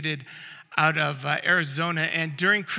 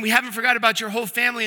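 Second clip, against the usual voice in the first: excited DJ; 4 semitones higher, 5 dB louder, faster than usual. Retold or imitated. Retold DJ announcing more gifts.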